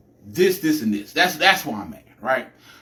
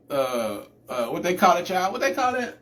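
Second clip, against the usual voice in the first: normal talking voice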